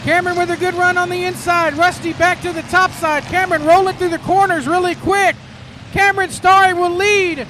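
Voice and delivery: lively live race call; motorsport commentator. Real, not acted. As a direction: call the laps as they unfold. Live race call after restart.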